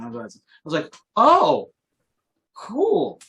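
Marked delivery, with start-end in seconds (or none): 1.2-3.2 high "oh", then drawn-out "cool"; retold wowed reaction; impressed realisation. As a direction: react as if it suddenly clicks. His impressed reaction to the demonstration.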